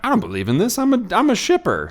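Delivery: high, loud mocking voice for a scoffing skeptic; hammed-up scoffing character line. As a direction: toss it off like a dismissive know-it-all. Voicing skeptical Harding's line.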